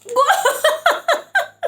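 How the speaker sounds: loud, high burst of laughter; real high-pitched, gleeful laughter; delighted laugh. Real, not acted. Reader sees her card confirm it, loud delighted laugh.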